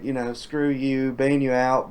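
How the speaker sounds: usual speaking voice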